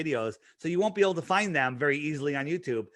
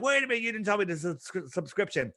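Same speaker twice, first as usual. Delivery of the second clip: mock complaint; 5 semitones higher, 3 dB quieter, faster than usual. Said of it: "people are like".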